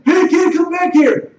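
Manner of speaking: put-on high, loud shout after a fleeing kid; yelling. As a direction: yell like a man calling someone back. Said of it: "the man starts yelling".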